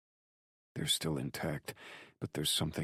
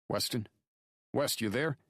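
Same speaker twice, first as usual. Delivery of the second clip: sharp, much higher-pitched call; cracking like a whip; 11 semitones higher, 4 dB louder than usual. A narrator's reading. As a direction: crack it like a whip to get his attention. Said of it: "Drake's voice cracks like a whip".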